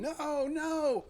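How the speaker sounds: high anguished scream of no. Retold or imitated. Officer hearing his brother drowned, screaming in anguish. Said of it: "he starts screaming".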